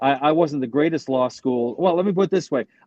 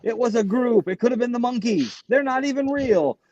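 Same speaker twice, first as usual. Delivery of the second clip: high-pitched comedy routine, climbing higher as it goes; Sam Kinison; 4 semitones higher than usual. Retold or imitated. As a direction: imitate a stand-up's rant, voice rising steadily. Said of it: "Sam Kinnison's routine about the Manson killers".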